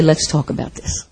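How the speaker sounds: "Let's talk about this" almost whispered, low and tender; soft gentle invitation. Retold or imitated. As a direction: say "Let's talk about this" softly, as to a hurting friend. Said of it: "I will scoop them up and say".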